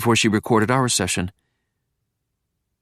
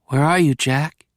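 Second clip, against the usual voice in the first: ordinary delivery, nothing marked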